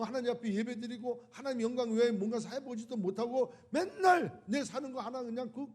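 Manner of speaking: ordinary delivery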